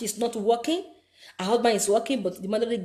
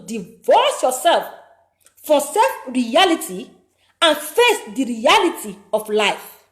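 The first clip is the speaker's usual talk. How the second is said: 1 slow emphatic command; 3 semitones higher, 9 dB louder, slower than usual. Acted.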